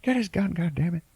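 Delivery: high mock yell; imitating a frantic brother yelling; hammed-up panicked shout. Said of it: "telling to his brother".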